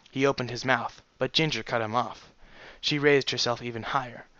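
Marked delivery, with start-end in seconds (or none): none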